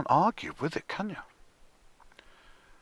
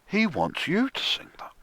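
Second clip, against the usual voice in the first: normal talking voice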